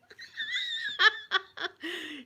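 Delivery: high, drawn-out burst of laughter; gleeful laugh. Real, not acted. Saying Granny possessed me that day, high laughing. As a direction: laugh helplessly at the memory. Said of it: "She'd have been laughing".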